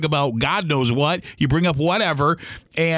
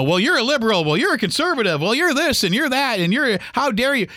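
mock accusing sister; 6 semitones higher than usual. Mimicking sister's name calling.

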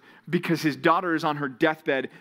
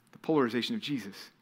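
normal talking voice

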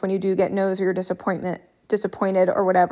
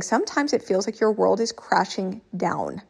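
ordinary delivery

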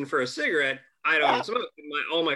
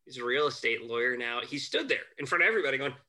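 ordinary delivery

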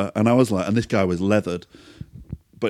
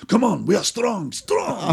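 put-on drunk Eastern European boast; 11 semitones higher, slower than usual. Drunk neighbour boasting to the narrator that they are strong, in a hammed-up Eastern European accent. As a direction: ham up a drunk bodybuilder.

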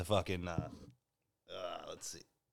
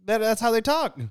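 exasperated explaining; 9 semitones higher than usual. Retold or imitated. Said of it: "I'm like".

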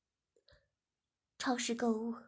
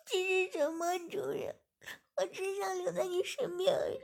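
tearful pleading; 8 semitones higher, 4 dB louder than usual. Acted. Girl pleads through tears.

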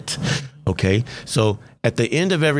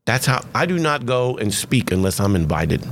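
usual speaking voice

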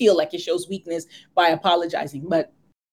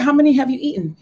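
sharp, high, loud demand; mock-angry mother grilling her son; 8 semitones higher, 4 dB louder, faster than usual. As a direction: confront the kid, voice up high. Mother confronts son over candy.

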